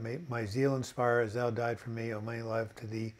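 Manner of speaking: soft, indistinct recital of a hymn; mumbling. Real, not acted. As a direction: mumble through the verse half to yourself. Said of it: "I'm, I'm mumbling".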